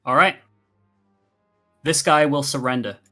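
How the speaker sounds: ordinary delivery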